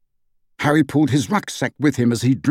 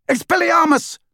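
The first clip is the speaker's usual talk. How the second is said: yelled spell. A narrator's reading. Harry disarming Stan, high yell. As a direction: fire the spell at the pursuer. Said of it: "Harry yelled".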